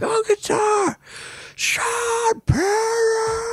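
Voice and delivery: high, drawn-out announcer calling out the band; arena announcer. Retold or imitated. Host mimics conductor introducing the drummer, stretched high announcer call. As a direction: hype each name like a concert MC.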